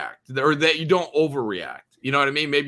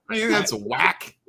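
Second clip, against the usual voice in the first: put-on slang voice; 5 semitones higher, slower than usual.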